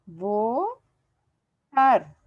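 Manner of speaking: "votar" in two slow syllables, vo... tar; split into syllables. Shown as a demonstration. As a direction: break "votar" apart, vo rising, long pause, tar falling. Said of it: "Aquí voy poniendo las sílabas".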